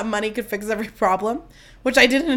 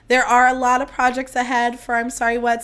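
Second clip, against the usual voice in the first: normal talking voice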